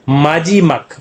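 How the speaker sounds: normal talking voice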